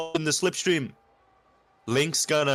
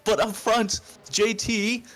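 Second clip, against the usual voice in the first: excited commentary; 7 semitones higher, 4 dB louder, slower than usual. Real, not acted. Cars collide on track.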